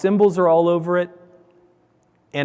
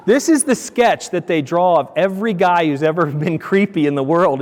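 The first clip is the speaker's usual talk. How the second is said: ordinary talk, nothing marked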